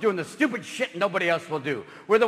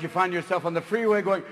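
ordinary delivery

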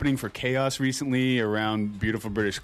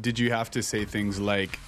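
usual speaking voice

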